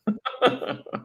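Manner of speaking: quick high laugh; high giggle. Real, not acted. Giggling after teasing about Kentucky. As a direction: giggle at the tease.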